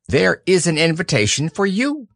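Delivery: high, fussy owl; pompous bird, a bit impatient. Acted. Owl speaking.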